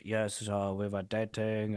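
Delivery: light, slow mock French accent; a hint of a French guy's accent. Quoting a French guy from an album, light French accent. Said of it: "some French guy that's like".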